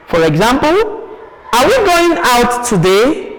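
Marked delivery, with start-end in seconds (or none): 1.5-3.3 higher question swinging up on "today"; rising intonation. Shown as a demonstration. Teacher asks the example question, rising intonation. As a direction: ask it with "today" going up. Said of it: "has to end with a rising intonation".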